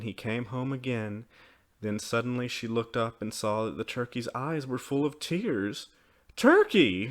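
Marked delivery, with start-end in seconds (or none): 6.4-7.1 high, loud cry of concern; exclaiming. A narrator's reading. Mother sees the child's tears, exclaiming. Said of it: "she exclaimed".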